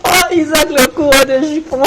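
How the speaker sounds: high teasing swoon; mock swooning. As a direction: tease him like a starstruck fan. Mocking the flirt character. (pâmoison moqueuse)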